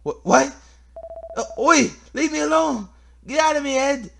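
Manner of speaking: high, loud startled protest; alarmed yelling. Acted. Game character telling someone to leave them alone, alarmed high yell.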